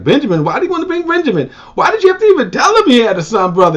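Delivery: high, fast, exasperated father; a father demanding why, at speed; father scolding sons for telling about Benjamin; upset father. Retold or imitated. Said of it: "Joseph's like".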